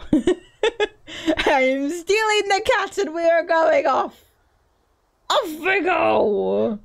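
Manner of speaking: high, drawn-out mock-villain glee; playful high thieving voice; gleeful cat thief. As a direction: cackle and make off with the loot.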